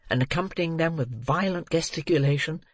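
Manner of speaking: usual speaking voice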